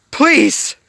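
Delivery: high, loud begging cry; desperate plea. A narrator's reading. Yokai begs Riley to stop, in a high loud desperate plea. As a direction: beg for your life, up high and loud. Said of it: "Yokai pleaded".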